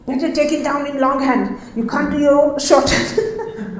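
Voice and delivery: a touch of a cross boss, half laughing; mock the boss's scolding, lightly; mildly imitated angry order; retold angry boss. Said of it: "One day he got so angry".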